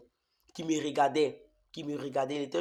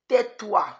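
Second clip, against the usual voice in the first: high, loud barked command; loud devil's command; devil's shout; 6 semitones higher, 6 dB louder than usual. Retold or imitated. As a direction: snap it like the devil. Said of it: "la voix du diable qui me dit".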